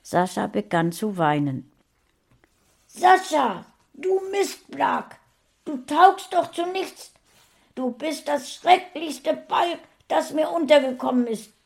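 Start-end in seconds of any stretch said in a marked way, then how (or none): 2.9-11.5 hohe, laute Keifstimme; wütender Schrei. A narrator's reading. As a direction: beschimpf das Kind voller Wut. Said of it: "schrie Saschas Mutter". (keifende, schreiende Mutter)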